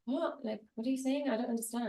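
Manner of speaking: high, soft confused partner; lightly acted puzzled voice; mock bafflement. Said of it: "And he's like".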